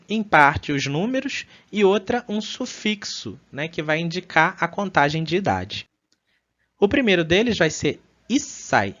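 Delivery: ordinary talk, nothing marked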